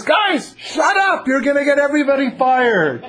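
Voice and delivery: high-pitched punchline shout; hammed-up exasperated baboon; baboon's scolding yell. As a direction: yell at your co-workers to shut up. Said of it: "a baboon next door".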